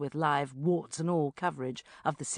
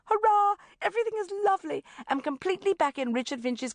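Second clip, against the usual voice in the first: much higher and louder cheer, then fast; delighted; 13 semitones higher, 4 dB louder, faster than usual. Acted. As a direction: burst out in triumph. Diary joy.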